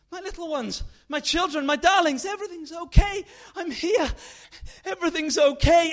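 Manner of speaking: high, very loud reassuring shout; frantic relief. Acted. Mother shouting to her children that everything is fine. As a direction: shout comfort through the panic.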